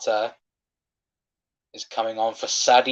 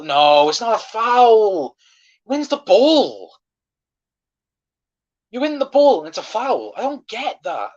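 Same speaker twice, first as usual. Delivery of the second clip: much higher and louder, slow, in disbelief; outraged protest; 11 semitones higher, 7 dB louder than usual. Real, not acted. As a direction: yell at the referee's call, stretching it out. Disputed foul against his team.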